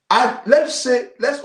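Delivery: loud exclamation. Real, not acted. Heated rant to viewers.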